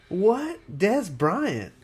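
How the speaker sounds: sudden high, loud what; shocked disbelief; surprised. Real, not acted. Sudden disbelief at a wrong name.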